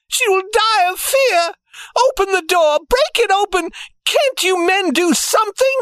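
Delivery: very high, panicked wail; terrified mother crying out for her child; frantic mother's cry. A narrator's reading. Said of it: "her mother cried".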